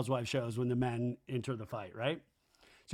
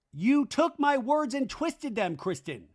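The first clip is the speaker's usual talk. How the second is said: slow, high, louder accusing retort; mock accusation; 8 semitones higher, 3 dB louder, slower than usual. Recapper imitating a cast member's accusation, theatrically indignant. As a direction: act out a drawn-out wounded accusation. Acting out a reality-show quarrel.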